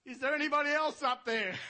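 loud, high yell upward; man on the branch yelling back at the Lord. Acted. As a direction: shout it up the cliff. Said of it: "the man yells back".

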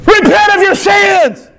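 high, drawn-out shouted call to repentance; urgent pulpit cry. Acted. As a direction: cry it out slowly to the whole church. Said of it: "the preacher stands at the pulpit and says".

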